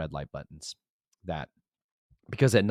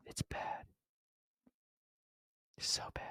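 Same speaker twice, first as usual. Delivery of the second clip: hushed, dramatic aside; grim whisper; 13 dB quieter than usual. Real, not acted. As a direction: whisper it with long heavy pauses. Dramatic aside about the mess.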